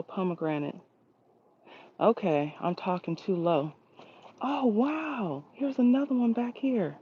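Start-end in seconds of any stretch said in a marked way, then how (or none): 4.4-7.0 higher, thrilled discovery; light up at the find; excited. Real, not acted. Finding another pomegranate on the tree, excited.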